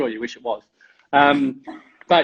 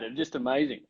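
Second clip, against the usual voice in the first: ordinary delivery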